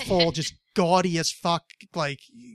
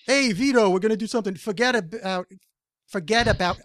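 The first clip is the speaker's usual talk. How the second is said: higher, louder New Jersey mobster voice; New Jersey accent; 4 semitones higher, 4 dB louder than usual. Pretend. Trying a New Jersey accent for a dare, loud mobster voice. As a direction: go full New Jersey wiseguy. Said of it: "If you try, I'll try".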